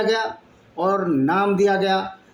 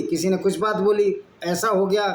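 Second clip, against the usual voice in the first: usual speaking voice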